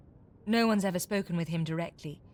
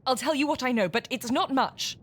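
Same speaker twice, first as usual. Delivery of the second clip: exasperated giving in; 6 semitones higher, 5 dB louder than usual. Acted. Cornered villain gives in.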